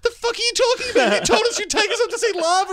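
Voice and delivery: mock protest. Group protests to the guide.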